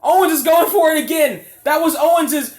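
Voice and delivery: high, loud commentator yell; excited shouting. Real, not acted. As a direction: scream the big spot. Frog splash through unbroken table.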